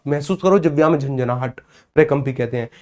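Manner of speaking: usual speaking voice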